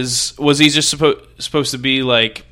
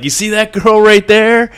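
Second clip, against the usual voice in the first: cocky singer; 6 semitones higher, 6 dB louder than usual. Retold or imitated. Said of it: "he was just like".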